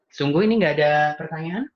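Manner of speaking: ordinary delivery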